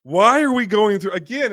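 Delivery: high, slow exasperated cry; real exasperated shout at a long scene. Real, not acted. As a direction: throw up your hands at the screen. Exasperated rant about film.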